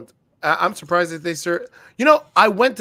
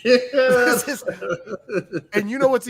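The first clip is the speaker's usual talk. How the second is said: laughing through the words; 6 semitones higher, slower than usual. Real, not acted. Storyteller laughing at his own Denny's story. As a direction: chuckle as you go on.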